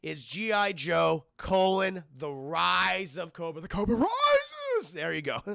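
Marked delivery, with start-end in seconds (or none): 3.6-4.9 high, slow theatrical villain voice; drawn-out mock-villain line; hammy villain catchphrase. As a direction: intone it like a gloating overlord.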